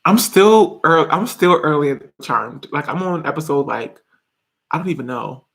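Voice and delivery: ordinary delivery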